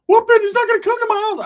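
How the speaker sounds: high, loud furious yell; Ricky yelling. Retold or imitated. Ricky refuses the guy at the house, high loud yell. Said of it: "Ricky finally is like".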